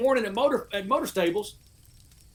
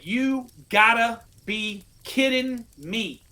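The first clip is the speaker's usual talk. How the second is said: slow catchphrase; much slower than usual. Retold or imitated. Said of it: "I had one made that said".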